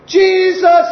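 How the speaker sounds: loud high call; crying out. Acted. Blind Bartimaeus calling to Jesus, crying out. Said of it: "he cried out all the more".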